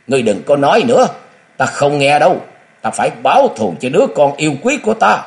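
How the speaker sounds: high, loud, rapid outburst; frenzied roar. A narrator's reading. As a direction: roar like a madwoman, refusing to listen. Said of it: "gầm lên như người điên".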